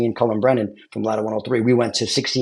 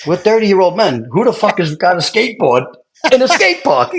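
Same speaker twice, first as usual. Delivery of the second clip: incredulous retort; 8 semitones higher, 3 dB louder than usual. Retold or imitated. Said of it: "We all look at each other and go".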